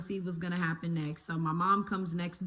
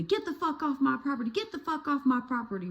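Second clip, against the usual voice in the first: fast, higher mock yell of the neighbor; imitating angry neighbor; 5 semitones higher, faster than usual. Storyteller imitating the neighbor chasing her off the property. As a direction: fire it off like the furious neighbor lady, but mocked. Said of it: "her telling me to".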